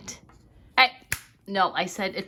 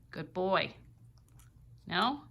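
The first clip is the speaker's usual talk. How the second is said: normal talking voice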